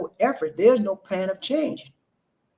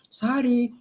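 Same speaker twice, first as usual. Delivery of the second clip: tossed-off sorry, pitched higher; careless mock sorry; 7 semitones higher than usual. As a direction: toss off a quick, careless sorry. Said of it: "They say that out in the Philippines".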